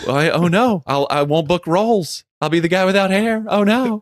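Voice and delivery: high, hammed-up panic over losing his hair; mock panic. Retelling his doctor's hair news, in hammed-up high panic. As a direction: wail it like the sky is falling.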